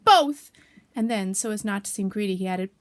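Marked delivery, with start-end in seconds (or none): 0.0-0.5 very loud, high one-word burst; explosive excited answer. Acted. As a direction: blurt it greedily. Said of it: "he was so excited that he said".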